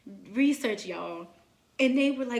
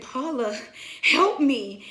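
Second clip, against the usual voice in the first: loud, raised-pitch plea; retold cry for help; 3 semitones higher, 8 dB louder, slower than usual. Labouring mother to her helper, retold urgent cry for help. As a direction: call out for help, louder on the plea.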